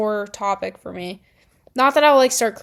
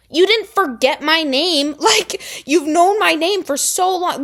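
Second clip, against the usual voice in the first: high, loud offended ranting; much higher and louder indignant rant; 8 semitones higher, 4 dB louder than usual. Retold or imitated. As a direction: vent your hurt and anger at him. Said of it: "I'm like".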